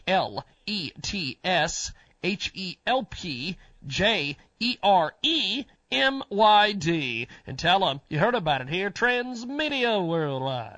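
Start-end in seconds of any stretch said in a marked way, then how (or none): 0.1-7.3 slow spelled-out address. Acted. 8.9-10.8 announcer sting. Acted. Sponsor name announced.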